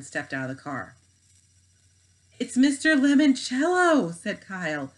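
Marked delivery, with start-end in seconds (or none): 2.4-4.1 higher, louder, slow amazed exclamation; a boy astonished to see his hero; excited. Acted. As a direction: gasp out the name in awe. Kids spot the famous game maker.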